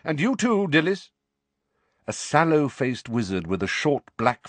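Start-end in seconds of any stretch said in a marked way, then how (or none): 0.0-1.1 sharp. A narrator's reading. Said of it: "he said sharply".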